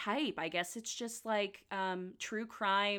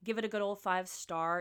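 usual speaking voice

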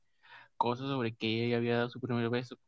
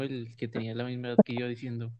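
normal talking voice

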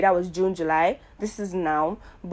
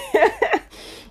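bursts into a high-pitched laugh; 6 dB louder than usual. Real, not acted. To listeners, laughing at her own oversharing, high laugh. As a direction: laughs at herself, pitch shooting up. Joking she needs therapy.

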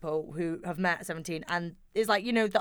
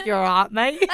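put-on Australian accent; 6 dB louder than usual. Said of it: "in their Australian accent".

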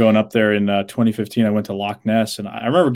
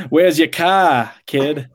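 put-on drawn-out, dropped-R Boston accent; slow, loud, higher Boston accent; 4 semitones higher, 4 dB louder, much slower than usual. Showing off the terrible Boston accent, Boston accent. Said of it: "in the Boston area".

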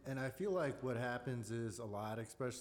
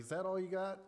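higher, louder mock taunt; imitating a movie boss goading a salesman; taunting challenge; 9 semitones higher, 5 dB louder than usual. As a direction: dare him to do better. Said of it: "he's like".

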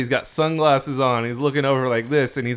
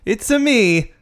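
high, louder cartoon Mario catchphrase; Mario character voice; 7 semitones higher, 3 dB louder, much slower than usual. Podcast host introduces himself to listeners as a Mario character. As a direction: sing out a Mario-style greeting. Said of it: "another voice from a Mario character".

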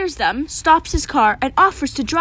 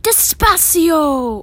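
long, drawn-out higher call; 4 semitones higher, 4 dB louder, much slower than usual. Acted. Nora telling the boat to slow down, calling out. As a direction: stretch the word out as a call. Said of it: "Nora calls out".

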